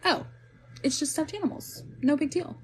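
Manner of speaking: put-on high little kid voice, casual relief; imitated relieved child. Mother voicing her kids once lights are on, relieved. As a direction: shrug it off in a high child's pitch. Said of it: "they were so terrified. But then".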